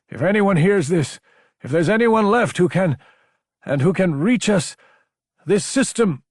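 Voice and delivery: high, pressing plea in broken bursts; distress call. A narrator's reading. Sender pleading to any survivors through static, urgent and halting. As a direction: call out to whoever may be listening.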